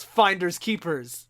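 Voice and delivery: villain Thanos. Retold or imitated.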